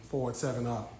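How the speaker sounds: ordinary talk, nothing marked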